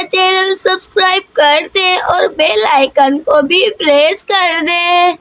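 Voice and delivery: slow, drawn-out granny delivery; elderly grandmother. Acted. Grandmother asking viewers to subscribe, slow elderly voice. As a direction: stretch the words out like an old granny.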